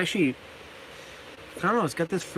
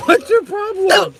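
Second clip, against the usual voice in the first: much higher, louder, drawn-out question; exasperated calling; 12 semitones higher, 7 dB louder than usual. Real, not acted. Owner to his barking dog mid-stream, exasperated loud call.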